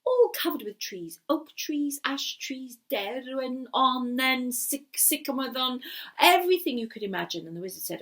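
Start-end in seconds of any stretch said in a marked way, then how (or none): none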